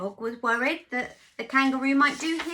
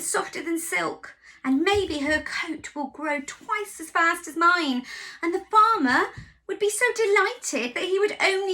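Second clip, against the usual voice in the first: higher, louder anxious sheep; 5 semitones higher, 4 dB louder than usual. Acted. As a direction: fret like a worried ewe. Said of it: "said Sheep".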